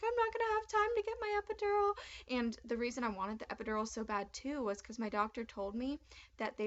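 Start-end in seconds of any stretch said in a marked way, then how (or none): 0.0-1.9 high, frantic worry; freaking out about running out of time; panicked. Retold or imitated. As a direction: blurt it in sudden panic. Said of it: "I, like, was freaking out".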